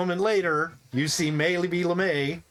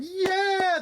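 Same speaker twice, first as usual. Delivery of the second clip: high cheering yell; mock crowd cheer. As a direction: whoop like the crowd.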